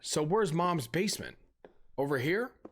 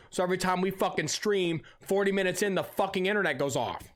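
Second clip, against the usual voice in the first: ordinary talk, nothing marked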